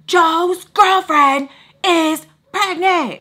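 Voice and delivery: loud, slow, high and exaggerated; sassy repeat. Roommate repeating the news to a doubter, loud sassy emphasis. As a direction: spell it out loudly, word by word, with sass. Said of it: "now she got a little sassy".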